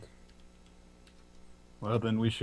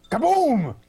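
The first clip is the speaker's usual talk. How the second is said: loud, high-pitched booming "Kaboom"; playful exclamation; 8 dB louder than usual. Pretend. Player cheering a roll on the table, booming exclamation. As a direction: burst out with a big, high boom.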